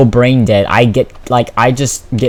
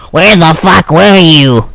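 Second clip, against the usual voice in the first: loud, raised, exaggerated teacher outburst; mock angry yell; 5 semitones higher, 8 dB louder, slower than usual. Mop-head teacher confronting a student, mock angry yell.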